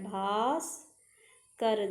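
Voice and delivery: भास्कर drawn out very slowly, each sound clear; slow teacher demo of भास्कर; slow spelled-out word. Shown as a demonstration. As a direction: stretch भास्कर out for the class. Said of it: "भास्कर ऐसे लिखा जाता है".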